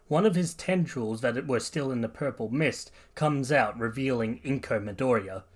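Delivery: ordinary delivery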